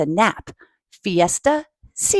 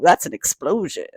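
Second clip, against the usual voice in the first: plain reading voice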